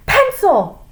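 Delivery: high, loud teacher's snap; snapped. A narrator's reading. Teacher correcting her slip to the class, snapped. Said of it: "snapped Missus Jules".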